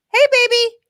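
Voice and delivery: high cooing pet voice. Real, not acted. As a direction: coo at the puppy. Greeting the dog.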